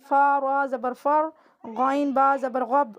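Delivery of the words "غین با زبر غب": ordinary talk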